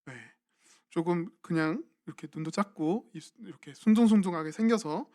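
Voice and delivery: ordinary talk, nothing marked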